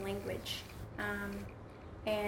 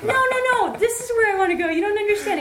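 very high, loud desperate pleading; exasperated protest; 12 semitones higher, 12 dB louder, faster than usual. Retold or imitated. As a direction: beg him to understand.

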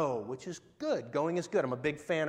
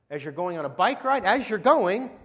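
growing louder, higher at the peak; building to a shout; 3 semitones higher, 8 dB louder than usual. Acted. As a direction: climb to the rallying cry. Building the list to a climax.

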